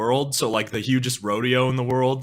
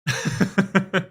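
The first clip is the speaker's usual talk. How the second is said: short laugh; 7 semitones higher than usual. Real, not acted. Laughs at own French.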